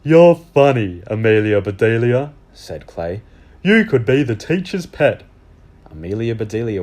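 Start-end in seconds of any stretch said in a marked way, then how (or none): 0.0-2.3 put-on teasing boy voice. Clay teases Amelia. 3.6-5.2 put-on teasing boy voice. Clay teases Amelia.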